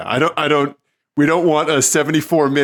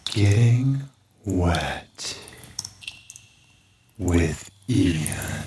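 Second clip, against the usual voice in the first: low, slow sultry announcer voice; 10 semitones lower, 7 dB quieter, much slower than usual. Acted. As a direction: purr the title deep and drawn out. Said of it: "Is it my sultry voice?".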